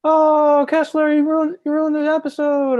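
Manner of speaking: loud, high-pitched sitcom outrage; exaggerated whine at being let down; Jerry. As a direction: wail it high and loud, dragging out the "Oh". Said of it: "Jerry says".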